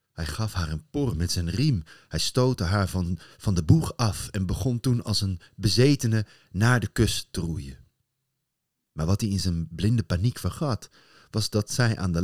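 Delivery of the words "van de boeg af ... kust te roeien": usual speaking voice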